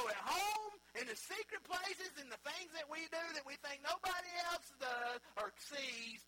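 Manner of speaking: high, loud, rising sermon climax; preacher thundering about God's eyes everywhere; preaching at full force. Acted. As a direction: drive it home to the congregation.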